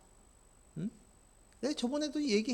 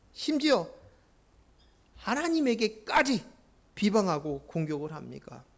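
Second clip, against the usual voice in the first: usual speaking voice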